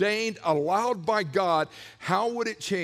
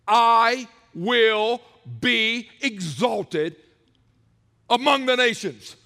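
very slow, much louder declaration; proclaiming loudly; 2 semitones higher, 6 dB louder, slower than usual. Shown as a demonstration. Preacher booming God's words to congregation. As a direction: thunder it out as God's voice. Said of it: "The next sentence reads".

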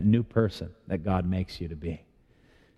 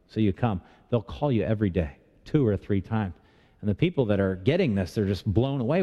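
ordinary delivery

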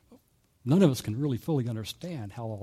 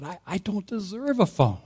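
tearful confession; 8 semitones higher than usual. Retold or imitated. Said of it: "he just broke down right there".

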